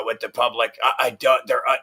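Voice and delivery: normal talking voice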